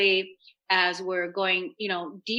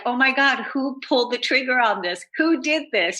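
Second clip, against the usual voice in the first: higher, louder mock alarm; retold outrage; 5 semitones higher, 4 dB louder than usual. Author retelling her dream about the book cover, mock-horrified. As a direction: act out being aghast at a discovery. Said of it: "I was like".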